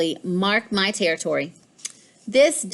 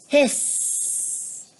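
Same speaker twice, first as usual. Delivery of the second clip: voiceless hissing sound; a sharp breathy cat hiss; 6 semitones higher than usual. A narrator's reading.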